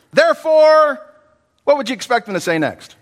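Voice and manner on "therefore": emphatic. Shown as a demonstration. Building to [name]'s point.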